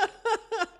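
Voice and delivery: short high burst of laughter; amused high giggle. Real, not acted. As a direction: let a real laugh escape. Host laughing at story.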